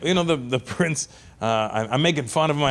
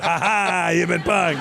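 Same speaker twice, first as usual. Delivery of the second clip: mock prankster; 4 semitones higher, slower than usual. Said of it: "Ashton Kutcher's gonna come out".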